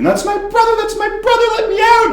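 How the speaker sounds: panicked high yell at the bartender; frantic scream. Retold or imitated. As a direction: scream to be let out to her brother. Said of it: "she starts screaming".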